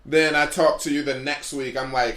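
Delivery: ordinary talk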